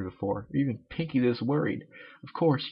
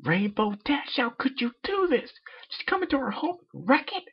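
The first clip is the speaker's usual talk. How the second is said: very high scolding rage; raging again; 14 semitones higher than usual. Acted. Miss Cake rages at Rainbow Dash in a very high voice. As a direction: let her rip at Rainbow. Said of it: "she started raging again".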